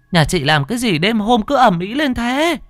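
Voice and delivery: put-on high, cranky old woman scolding; shrill-free old granny grumble, pitched high; grumpy grandma. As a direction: scold her like a woken grandmother. Grandma woken by the noise.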